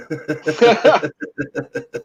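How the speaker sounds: long high giggling laugh; helpless high-pitched laugh at own joke; cracking up. Real, not acted. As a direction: burst into high, rippling laughter.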